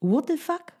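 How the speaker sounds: high-pitched inner WTF; inner disbelief. Retold or imitated. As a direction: squeak it out in shock. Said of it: "W mojej głowie".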